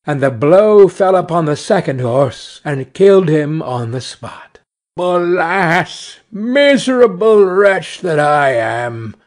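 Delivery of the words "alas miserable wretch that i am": woeful storybook lament; mildly wailed despair; crying out. A narrator's reading. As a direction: bemoan your ruin like a wretched carter. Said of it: "cried he".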